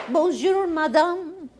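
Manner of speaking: French lady's high-pitched greeting; French accent. Retold or imitated. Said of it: "The lady says".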